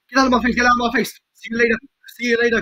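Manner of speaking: fast, loud taunts; rapid-fire shouting. Pretend. Player chasing off game enemies, shouting fast. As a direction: rattle it off loudly at the enemy.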